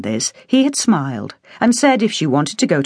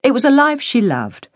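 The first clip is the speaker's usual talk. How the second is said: ordinary delivery, nothing marked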